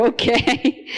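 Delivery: laughing through the word, amused; amused laughing okay. Retold or imitated. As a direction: chuckle it out in disbelief. Amused at Jesus quoting movie.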